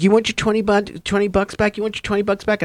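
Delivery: high-pitched, needling question; retold taunt.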